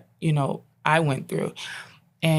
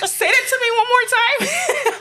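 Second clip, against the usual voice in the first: high, loud laughing plea to hear it again; laughing with joy; 5 dB louder than usual. Real, not acted. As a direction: laugh out the words in disbelief. Son just spoke first time.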